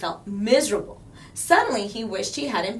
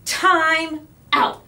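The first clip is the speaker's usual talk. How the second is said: stern teacher; 8 semitones higher, 8 dB louder than usual. A narrator's reading. Teacher scolding Eddie.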